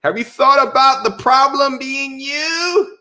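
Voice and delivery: mock condescending. Mocking a condescending lecturer.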